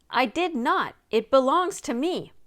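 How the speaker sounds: loud, high indignant protest; angry denial. Acted. The sailor loudly denying to Nancy that he stole the box. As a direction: push back hard against the charge. Accused sailor denies theft.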